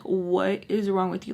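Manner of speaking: ordinary talk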